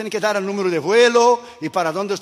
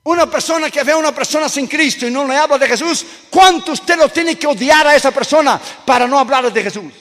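rising preaching; 7 semitones higher, 7 dB louder than usual. Acted. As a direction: build to an ecstatic climax. Preaching challenge.

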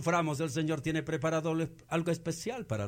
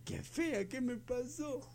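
mock dismay; 8 semitones higher, 7 dB quieter, slower than usual. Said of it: "te va a decir".

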